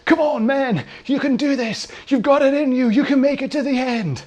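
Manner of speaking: high-pitched mock pep-talk shout; put-on hype, voice pushed way up; imitated motivational shouting. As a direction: imitate an over-eager coach urging someone on. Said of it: "someone started shouting at me".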